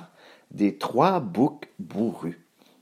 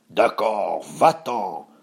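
growly troll voice, gloating at the end; troll savouring the coming meal; greedy troll. Acted. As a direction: grant it grudgingly as a hungry monster.

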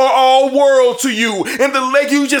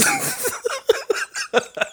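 high laugh; 12 semitones higher, 8 dB quieter than usual. Real, not acted. Laughs at own insult.

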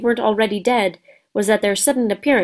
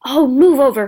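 loud, impatient snarl; 4 semitones higher, 6 dB louder than usual. Acted. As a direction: shove past with the voice. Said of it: "Hermione snarled".

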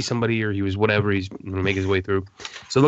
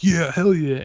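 higher, louder triumphant cheer; 8 semitones higher, 4 dB louder, slower than usual. Retold or imitated. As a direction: celebrate getting the autograph. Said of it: "Legend's like".

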